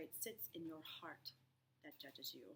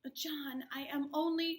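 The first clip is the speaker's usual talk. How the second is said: much higher, louder plea; wife desperate to be heard; pleading; 7 semitones higher, 7 dB louder than usual. Acted. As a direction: push back, pleading hard.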